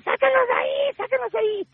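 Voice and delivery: very high, shrieking plea; imitating a terrified yell for help; panicked scream. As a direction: manager screaming down in terror to get them out. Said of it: "Tatiana empezó a gritar".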